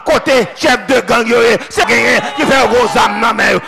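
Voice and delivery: loud, rhythmic Haitian sermon cadence; fiery pulpit preaching building up; full-force preaching. Acted. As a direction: preach it out to the whole church. Preaching to the congregation.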